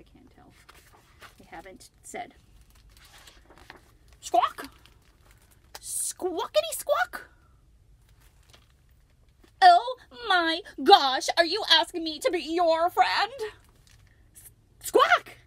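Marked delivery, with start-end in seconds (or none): 4.2-7.3 high, loud, slow squawking; flamingo squawk. Acted. Flamingo calls. 9.6-13.3 put-on high, loud, slow-starting excited girl voice; thrilled. Lucy overjoyed. 14.3-15.4 very high, loud squawk; flamingo squawk. Acted. Flamingo answers.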